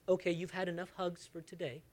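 softly spoken mock brush-off; quietly fob off a child; understated dismissive parent voice. Imagined parent rationing affection.

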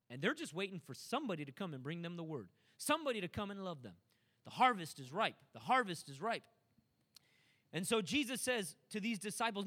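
ordinary delivery

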